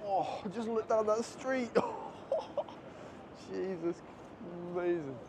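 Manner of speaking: high, slow, breathless wonder; awestruck. Real, not acted. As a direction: gasp at the view. Awed at street view.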